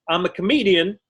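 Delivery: ordinary talk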